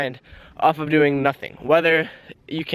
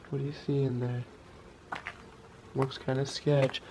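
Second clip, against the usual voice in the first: quiet, hushed near the bees; 3 semitones lower, 13 dB quieter than usual. Real, not acted. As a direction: keep it low so the bees stay calm. Peeking at bees by door.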